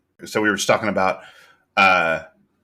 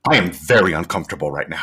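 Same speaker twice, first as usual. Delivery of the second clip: lower, quick, uneasy aside; mock discomfort; 6 semitones lower, faster than usual. Co-host voicing Cindy's inner reaction, uncomfortable. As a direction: drop the pitch and rush out the awkwardness.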